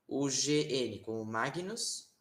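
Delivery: normal talking voice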